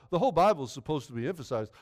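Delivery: ordinary delivery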